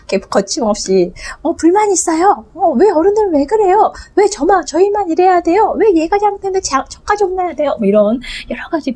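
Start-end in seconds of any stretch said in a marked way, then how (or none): 1.4-7.8 put-on high complaining child; child's voice. Child protesting to grown-ups, high and whiny. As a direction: whine it like a kid blamed unfairly.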